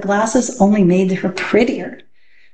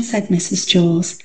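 usual speaking voice

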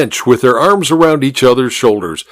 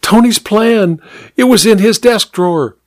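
tearful excitement; 10 semitones higher than usual. A narrator's reading. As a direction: gasp it out through tears. Tearful housemaid reveals plan.

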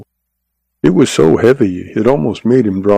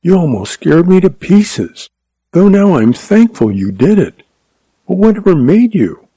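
higher, lighter voice for Jean, happy; higher voice of a happy young wife; young woman's voice; 8 semitones higher than usual. A narrator's reading. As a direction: play the relieved young bride, much higher. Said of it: "spoke to him".

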